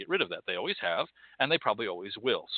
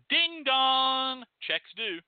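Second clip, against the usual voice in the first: ding dong; 12 semitones higher, much slower than usual. Pretend. Announcing bill due.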